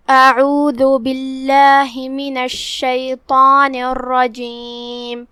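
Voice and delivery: slow, drawn-out melodic tilawah; Arabic tajweed recitation; Quran recitation. Acted. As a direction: recite with long tajweed vowels.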